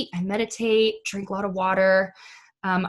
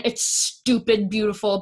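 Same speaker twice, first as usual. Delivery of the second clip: normal talking voice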